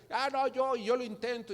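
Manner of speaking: high, jumping in to volunteer; acted keen taker of a dare; eager volunteer. Acted volunteer answering a challenge.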